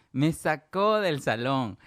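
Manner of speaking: slow, loud stress on "sacó"; drawn-out emphasis. Real, not acted. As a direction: stretch out "sacó" with force. Repeats punchline slowly for emphasis.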